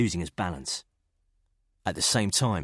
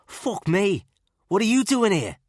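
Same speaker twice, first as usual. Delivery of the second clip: surprised Scouse; 14 semitones higher, 8 dB louder than usual. A narrator's reading. Killen surprised to see Gardner.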